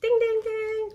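high, loud sing-song ding ding ding; game show bell. Pretend. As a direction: ring it out like a quiz show bell. Correct answer, game-show bell.

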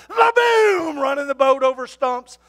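loud, excited retelling of the boat ride. Retold or imitated. Preacher telling the church about the boat ride, excited and loud. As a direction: shout it like the boat is flying.

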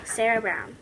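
ordinary talk

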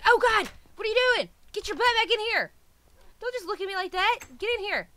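shouting at the dog to get back in; startled yell at the pet. Real, not acted. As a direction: yell the runaway back inside. Pet runs out the door.

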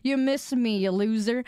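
ordinary talk, nothing marked

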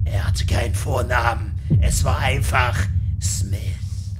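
low, rough, whispery legend-teller; mock-dramatic narrator. Player reads a gravestone name, mock-dramatic narrator. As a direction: intone it low and slow like a dark legend.

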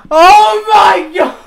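slow, stunned yell; surprised shout. Real, not acted. Another donation.

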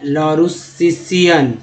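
लॉरुसिसियन broken into slow syllables; careful stretched लॉरुसिसियन; slow word demo. Shown as a demonstration. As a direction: sound out लॉरुसिसियन part by part. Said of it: "इस तरह से आप पढ़ना सीख".

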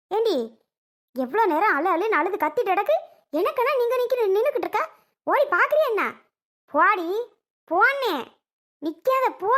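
high-pitched, exasperated scolding; angry yelling. Acted. Selvi orders Soni to go to the baby, yelling angrily. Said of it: "ஏன் கத்துற செல்வி?".

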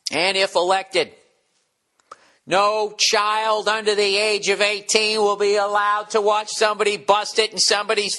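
hammed-up presidential pledge, drawn out; mock campaign speech.

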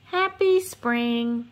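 slow, drawn-out, sweet holiday wish; cheerful greeting. Acted. Narrator wishing young viewers happy spring, slow and sweet.

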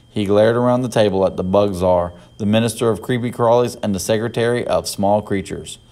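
ordinary delivery, nothing marked

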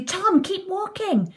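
high, drawn-out urging call; excited shout. A narrator's reading. Sylvie urging Tom to keep crunching, excited call. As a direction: call out eagerly, stretching the words. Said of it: "shouts Sylvie".

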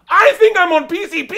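high, loud freak-out; panicked yell. Retold or imitated. Comic replays his terror as a fight breaks out, panicked yell. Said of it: "like, panicking".

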